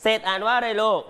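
normal talking voice

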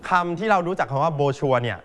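usual speaking voice

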